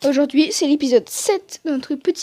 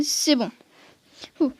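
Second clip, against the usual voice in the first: ordinary talk, nothing marked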